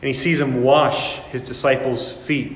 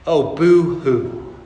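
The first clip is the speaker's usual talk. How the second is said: slow sarcastic boo-hoo; mock boo-hoo; much slower than usual. Mocking someone's trouble, slow sarcastic whine. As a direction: drag out a sneering boo-hoo.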